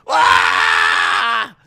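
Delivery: long, very loud acted roar; wrestler's roar. Veer popping up in the car, long acted roar. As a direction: leap out with a huge yell.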